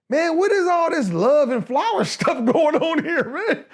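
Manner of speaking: high, drawn-out mock exasperation; incredulous complaint. Baffled new student griping about Rumi in class, incredulous. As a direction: complain slowly and high, half laughing.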